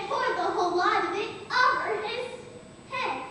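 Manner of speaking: emphatic order. Acted. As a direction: call out the order with relish. Acting out the bucket order.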